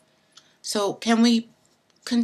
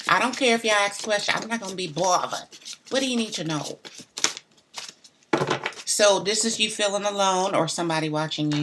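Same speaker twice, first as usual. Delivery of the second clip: ordinary delivery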